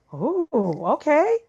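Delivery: high-pitched, rising mock surprise; taken-aback reaction. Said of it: "Kinda strong with that".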